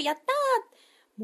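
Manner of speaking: high, slow cheer; drawn-out happy yay. Real, not acted. Cheering at game update.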